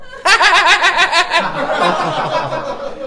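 high, pulsing forced laugh close to sobbing; laugh like crying. Pretend. Straight man insisting he is happy, tearful-sounding fake laugh. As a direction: pass off blubbering as laughter, comically. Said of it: "您这乐比哭还难看呢".

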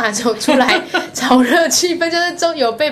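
sheepish laughing admission. Real, not acted. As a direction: laugh mid-sentence. Laughing at her own misstep.

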